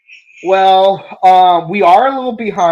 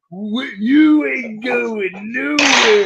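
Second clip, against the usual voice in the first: villain boss voice; 7 semitones higher, much slower than usual. Pretend.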